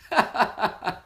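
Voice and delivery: short warm chuckle; real laugh. Real, not acted. Teacher laughs at her own story, chuckling. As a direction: laugh at the punchline.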